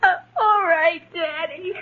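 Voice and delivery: subdued sniffly assent, fading away; tearful. Acted. Girl sent upstairs by father, still tearful. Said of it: "please stop crying".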